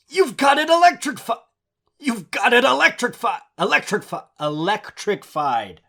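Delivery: high, halting retries of electrified; high stumbling voice. Shown as a demonstration. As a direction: stammer on electrifi- until it comes out. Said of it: "that is so hard to say".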